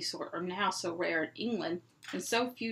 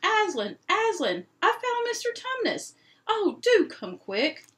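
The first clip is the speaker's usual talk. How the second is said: high, loud and thrilled; Lucy calling Aslan after finding Tumnus; shouting out; 11 semitones higher, 7 dB louder than usual. Acted. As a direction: yell the news up the stairs. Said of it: "shouting out".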